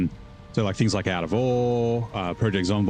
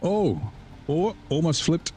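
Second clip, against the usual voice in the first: startled; 8 semitones higher, slower than usual. Real, not acted. Near flip in game.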